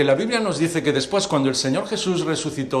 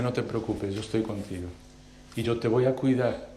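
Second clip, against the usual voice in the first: softer, gentle comfort; tender reassurance; 4 semitones lower, 6 dB quieter than usual. Acted. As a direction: hold him and calm his fear. God comforting José. (consuelo tierno y suave)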